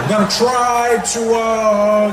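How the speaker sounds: halting, buffering delivery with long uhs; Barack Obama. Acted. President addressing Americans, voice stalling like it's buffering. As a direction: stall between phrases, loud and measured. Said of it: "his voice sounded like it was buffering".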